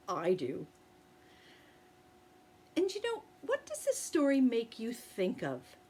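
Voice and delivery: bright, friendly teacher voice. Real, not acted. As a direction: chat warmly with the class. Teacher chats to children.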